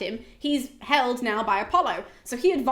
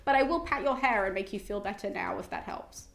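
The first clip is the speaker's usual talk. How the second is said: brisk offhand consoling; faster than usual. Retold or imitated. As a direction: rattle off half-hearted comfort. Dione's offhand reply to crying daughter.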